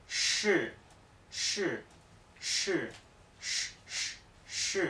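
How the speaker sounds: "sh" repetido con mucho aire; sonido sh. Shown as a demonstration. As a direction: sopla fuerte la "sh". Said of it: "se pronuncia". ("sh" soplado y sostenido)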